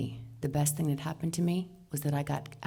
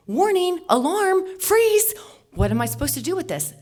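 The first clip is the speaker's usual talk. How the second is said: much higher and louder, like a siren in her head; panicked alarm; 13 semitones higher, 9 dB louder than usual. Retold or imitated. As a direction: inner alarm going off. Brain on lockdown, panic.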